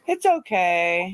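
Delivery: ordinary delivery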